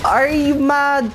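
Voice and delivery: high, slow, stretched mocking jeer; drawn-out taunt. Real, not acted. Gamer rubbing it in to an enemy, slow stretched high jeer. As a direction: drag it out to rub it in.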